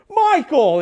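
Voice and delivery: calls the name out loud and high; a big happy shout across the lot; shouting an old friend's name in delight; joyful shouted name. Retold or imitated. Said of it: "hick talking back and forth".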